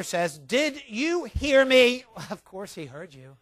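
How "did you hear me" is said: slow, louder, higher angry demand; angry father losing patience. Retold or imitated. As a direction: spell each word out in anger. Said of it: "With anger".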